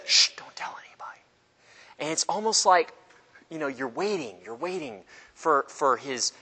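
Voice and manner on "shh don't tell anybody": fast secretive whisper; mock hush-hush whisper; urgent hushing. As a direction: shush and whisper it hurriedly. Jesus hushes them again.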